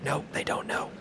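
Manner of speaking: hushed sneaking whisper. Real, not acted. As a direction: whisper so they don't hear. Sneaking past enemies.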